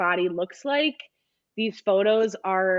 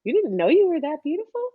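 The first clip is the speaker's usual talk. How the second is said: high incredulous question, swooping upward; amazed, higher-pitched echo question; retold disbelief; 7 semitones higher, 4 dB louder, faster than usual. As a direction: replay your astonishment, pitch leaping high. Reacting to sister's confession.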